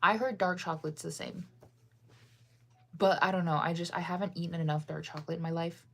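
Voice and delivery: normal talking voice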